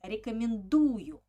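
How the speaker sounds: firm accent on ДУ in рекомендую; stress on У. Shown as a demonstration. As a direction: punch the У in рекомендую. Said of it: "u is also ha-, uh, is also with accent".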